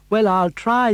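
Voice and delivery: high, loud eager puppet; eager Pinocchio. Acted.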